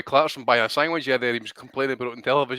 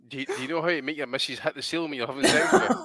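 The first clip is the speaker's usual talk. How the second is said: normal talking voice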